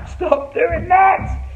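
high, loud and very slow mock scolding; pretend-stern shout at the kids. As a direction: tell them off playfully, stretching it out. Scolding the audience mid-trick.

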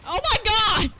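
loud, high outburst; exclaimed shout. Real, not acted. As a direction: yell it in disbelief. Exasperated at respawning enemies.

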